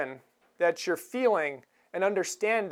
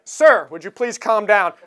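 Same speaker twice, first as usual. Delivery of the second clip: firm order; 8 dB louder than usual. Retold or imitated. Marshal orders calm.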